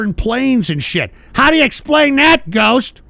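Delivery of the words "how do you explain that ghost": higher and louder, imitating a skeptic; mock challenge. Host imitating doubting viewers, mock loud challenge. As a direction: throw the challenge like a heckler. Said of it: "people are gonna say".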